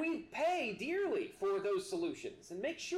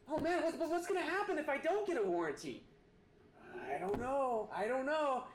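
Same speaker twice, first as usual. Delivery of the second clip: higher, hurried fretting shopper; anxious customer; 7 semitones higher than usual. Pretend. Preacher mocking a nervous shopper offered a warranty, fretting and higher. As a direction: play the worried buyer talked into it.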